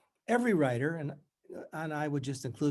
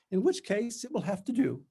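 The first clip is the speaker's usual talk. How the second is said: strangulated faux brightness; 8 semitones higher than usual. A narrator's reading. Tutor greets her old student, strangulated and falsely bright. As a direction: push it through gritted teeth with forced cheer. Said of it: "In her strangulated voice".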